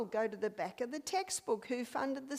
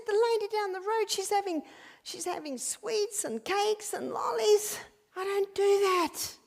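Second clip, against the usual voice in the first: mimicking the lady; 9 semitones higher, 8 dB louder than usual. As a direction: squeal it like the indignant lady mocking her neighbour. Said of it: "She said".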